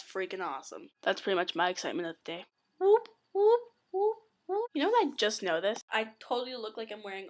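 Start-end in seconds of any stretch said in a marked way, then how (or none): 2.8-4.7 high, short repeated whoops; playful whooping. Pretend. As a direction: whoop like a silly siren.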